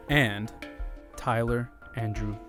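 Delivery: ordinary talk, nothing marked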